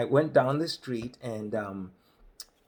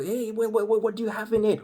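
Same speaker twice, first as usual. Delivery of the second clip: eager farmer; 9 semitones higher, 3 dB louder, faster than usual. Retold or imitated. Man on tractor quizzing him.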